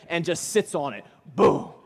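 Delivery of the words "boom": loud, explosive boom; emphatic boom. Retold or imitated. Preacher mimics John landing on the trunk, loud boom. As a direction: punch out one booming impact.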